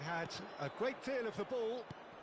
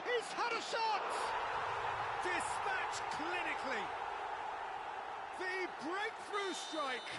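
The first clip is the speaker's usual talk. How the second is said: high, ringing commentator's shout, stretching the final words; excited goal call; 10 semitones higher, slower than usual. Real, not acted. Commentator announcing the breakthrough strike to viewers, excited shout. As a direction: cry out the goal as it goes in.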